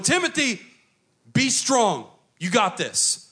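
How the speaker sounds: high, loud coach urging Timothy on; coach pep talk. Retold or imitated. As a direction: push him like a coach at halftime. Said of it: "speech from a coach".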